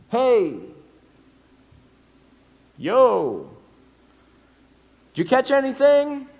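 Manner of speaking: high, loud call across the water; a stranger hailing fishermen from the shore; calling from a distance. Acted. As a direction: shout out to the boat.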